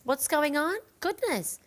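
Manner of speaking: high exclamation; surprised. Real, not acted. Host reacting to orders flooding in, surprised. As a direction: marvel at the sudden sales.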